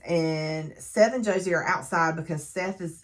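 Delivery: ordinary talk, nothing marked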